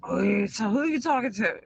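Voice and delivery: put-on boyfriend voice. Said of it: "he goes".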